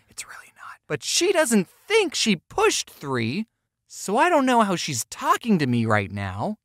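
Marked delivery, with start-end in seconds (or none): 0.1-0.8 quick unvoiced whisper to audience; whispered aside. Real, not acted. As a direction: drop to a secret whisper.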